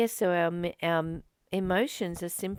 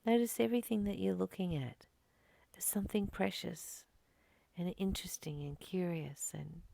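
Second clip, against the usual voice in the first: guided meditation; 3 semitones higher, 4 dB quieter than usual. Acted. Teacher guiding her students back to stillness, softly whispering. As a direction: coax them gently into noticing the moment.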